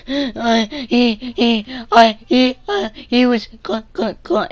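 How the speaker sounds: high teary stammer, imitating; stuttering crybaby. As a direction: stammer like a kid about to cry. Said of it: "stuttering thing".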